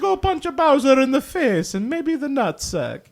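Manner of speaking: high, loud and excited; squeaky plumber, full of gusto; Mario voice. Retold or imitated. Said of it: "Not like".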